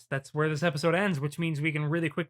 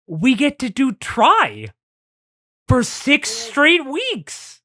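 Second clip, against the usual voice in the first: hammed-up emphasis; 9 semitones higher, 6 dB louder, much slower than usual. Mock dread of long schedule.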